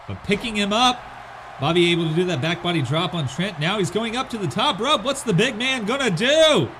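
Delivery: play-by-play wrestling call; wrestling commentator. Acted. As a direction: call the match like a ring announcer.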